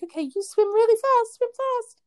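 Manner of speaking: put-on very high, sing-out voice to a little kid; imitated playful child-talk. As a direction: egg on a small child in a pretend chase. Playing crocodile game with small boy.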